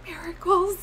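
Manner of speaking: high, soft, choked-up wonder; tearful awe, reenacted. Friend watching a miracle happen, high soft mock-tearful awe. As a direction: squeak it out, overcome. Acts out her own awed reaction.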